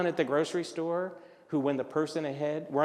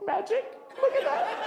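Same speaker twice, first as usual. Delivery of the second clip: very high, laughing falsetto; squeaky mock wonder. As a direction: sing out a cartoonish high-pitched squeal. Cashier's change trick, he's amused.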